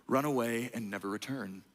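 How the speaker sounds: a low hushed Scar; sly low villain order; whispered villain command. Acted. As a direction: lean in and push him away quietly. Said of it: "the enemy whispers to you".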